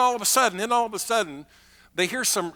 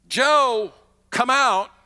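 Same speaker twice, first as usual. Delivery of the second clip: commanding call; 5 semitones higher, 6 dB louder, much slower than usual. Pretend. Preacher acts calling dead man.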